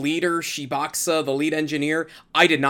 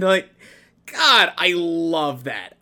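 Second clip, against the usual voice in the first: usual speaking voice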